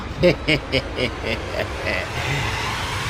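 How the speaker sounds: crazed cackle; broken mad laugh. A narrator's reading. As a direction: laugh like a man losing his mind. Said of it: "um riso quebrado, louco".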